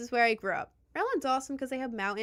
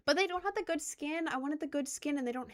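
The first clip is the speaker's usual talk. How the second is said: high-pitched plaintive complaint; playful high-pitched pouting; mock whining; 6 semitones higher than usual. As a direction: whine it like a let-down kid. Mock complaint about missing game skin.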